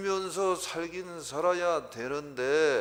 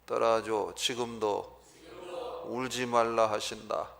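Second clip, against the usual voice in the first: ordinary talk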